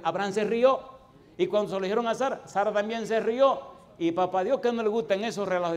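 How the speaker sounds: loud, high, fervent sermon delivery; preaching at full force. Acted. Pastor booming the Abraham story to the congregation, full-force preaching. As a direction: preach it hard, voice climbing.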